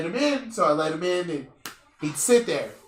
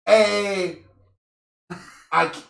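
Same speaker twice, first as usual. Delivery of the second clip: louder, stammering fake excuse to a friend; mock lame excuse; 6 dB louder, slower than usual. Dodging his friend's workout invite with a stammered, loud fake excuse. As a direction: fumble for a bad excuse, a bit overplayed. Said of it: "I'm like".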